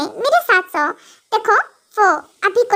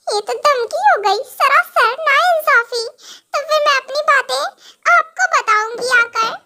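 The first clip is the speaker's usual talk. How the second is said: slow, high, half-laughing mock sob; hammed-up teary protest; whiny childish wife; 5 semitones higher, slower than usual. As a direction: drag it out like a sulking child. Wife protests his threat.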